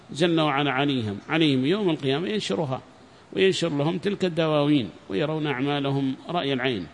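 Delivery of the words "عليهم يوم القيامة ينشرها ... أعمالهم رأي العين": normal talking voice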